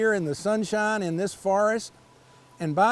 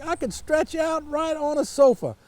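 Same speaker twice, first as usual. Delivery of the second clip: hopeful frog; 8 semitones higher than usual. A narrator's reading. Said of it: "said the frog".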